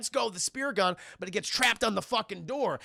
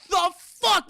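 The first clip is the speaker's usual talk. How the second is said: shocked shout; 10 semitones higher, 7 dB louder than usual. Retold or imitated.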